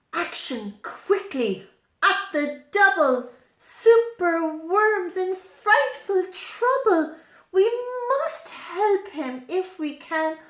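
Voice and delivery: rally the friends to the rescue, a touch urgent; storybook call to action; mildly urgent rallying cry. Acted. Friends rally to save Superworm.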